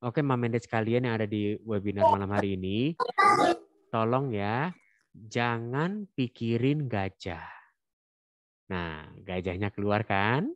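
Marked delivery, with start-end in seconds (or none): 0.0-7.4 slow, louder demo line; slow, emphatic instruction to the audience; demonstration. Shown as a demonstration. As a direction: stretch out the last words slowly. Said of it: "Contohnya, kalau saya bilang".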